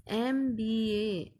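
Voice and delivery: एमबीए drawn out slowly, em sound first; slow demo of एमबीए. Shown as a demonstration. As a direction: stretch एमबीए so the em is clear. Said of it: "एम किससे start हो रहा है". (एमबीए धीरे से, एम पर ज़ोर)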